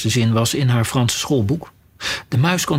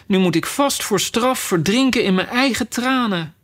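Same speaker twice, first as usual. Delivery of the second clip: put-on higher, a touch plaintive girl's voice; lightly voiced young Alice; 7 semitones higher than usual. A narrator's reading. Alice, swimming in her own tears, speaks a touch plaintively. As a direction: voice Alice lightly, pitched up, a little worried.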